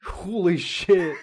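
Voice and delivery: slow drawn-out Pittsburgh holy shit; Pittsburgh accent. Shown as a demonstration. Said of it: "I sound like I'm from Pittsburgh".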